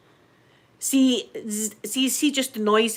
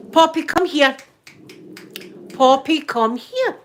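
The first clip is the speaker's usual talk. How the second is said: calling the dog; 5 semitones higher, 5 dB louder than usual. Real, not acted.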